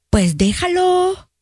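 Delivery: high, drawn-out imitation of a friend; mock casual advice. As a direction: wave it away in a high, stretched-out voice. Imita a amiga que aconseja mal. (consejo fingido, agudo y alargado)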